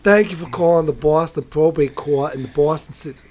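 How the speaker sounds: mock Boston court recording, a bit loud; Boston accent. Caller mocking Boston court phone greeting in Boston accent. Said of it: "the accent progressively get more annoying".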